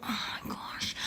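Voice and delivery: imitated huffy whisper, barely audible; exasperated whisper. Customer rolling her eyes while paying, exasperated whisper. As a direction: breathe it out as a mock exasperated sigh.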